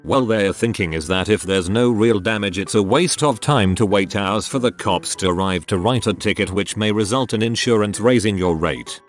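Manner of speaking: usual speaking voice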